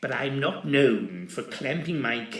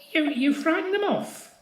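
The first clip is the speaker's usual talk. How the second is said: much higher squeaky stammer; squeaked; 3 dB louder than usual. Acted. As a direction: squeak it out in panic. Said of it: "Polkinghorne squeaked".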